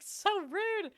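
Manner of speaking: very high outraged so rude; indignant squeal. Real, not acted. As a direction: squeal it in disbelief.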